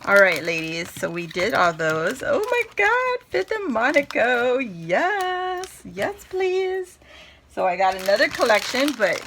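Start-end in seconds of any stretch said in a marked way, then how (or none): 2.2-4.6 excited squeal. Real, not acted. Excited unboxing gasp. 4.6-7.0 high excited yes. Real, not acted. Thrilled at the paper.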